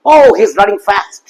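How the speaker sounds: drawn-out example sentence, long vowel in FAST; slow model sentence with FAST held long; long 'a' in fast. Shown as a demonstration. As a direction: stretch OH and the 'a' of FAST slowly. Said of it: "এটা হচ্ছে long অ্যা".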